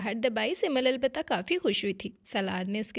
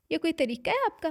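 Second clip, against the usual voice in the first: mildly cross, higher scolding question; indignant; 6 semitones higher, 4 dB louder than usual. Acted. Wife scolding husband for his bad manners, mildly indignant. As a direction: tell him off, a little affronted. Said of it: "वो खफकी से बोली थी".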